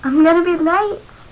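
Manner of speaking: high, loud panicked cry. A narrator's reading. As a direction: yell it in a panic. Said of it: "I cried".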